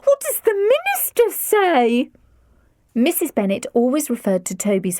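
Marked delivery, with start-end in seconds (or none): 0.0-2.1 distraught mother. A narrator's reading.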